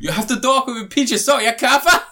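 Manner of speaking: hammed-up DiCaprio Blood Diamond accent, climbing to a yell on Kaffa. Mocking DiCaprio's accent in Blood Diamond, high and loud imitation. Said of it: "friggin' Leonardo DiCaprio in Blood Diamond".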